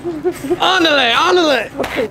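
high, drawn-out "andale" cheer; playful urging. Pretend. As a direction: whoop it to hurry someone along. Calling out in store ride.

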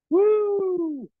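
very high whooping cheer; excited whoop. Real, not acted. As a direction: whoop through the effort. Workout exertion cheer.

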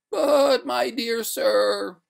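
high, loud, drawn-out protest; reluctant ghost. A narrator's reading. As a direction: wail a slow, reluctant objection. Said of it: "returned the ghost with a pale reluctance".

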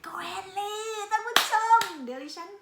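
high, loud, slow announcement; excited announcement. Real, not acted. Announcing the forest trip to viewers, high and loud.

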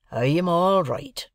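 slow, drawn-out gruff answer. A narrator's reading. Journeyman answers his master after cooling down, gruff. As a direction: answer gruffly, stretching the words. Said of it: "said gruff old Orlick".

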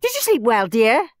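high, loud breakfast question from an aunt; kindly aunt. A narrator's reading. Aunt asks Henry at breakfast, kindly aunt voice.

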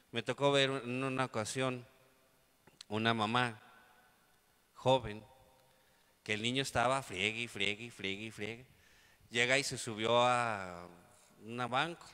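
normal talking voice

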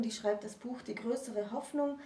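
usual speaking voice

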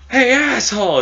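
high, loud hostile holler; retold intimidating yell; mock intimidation. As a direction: shout it to scare them off. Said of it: "we gotta, like, intimidate them".

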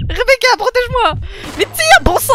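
high, loud yell for cover; frantic, high-pitched cry for help mid-attack; panicked shouting. Real, not acted. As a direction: shout at your partner to shoot. Calls to game partner under attack.

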